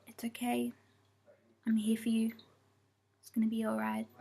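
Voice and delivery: hushed, half-whispered reassurance; soft comforting. Retold or imitated. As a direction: soothe a frightened pet. Comforting her sedated cat.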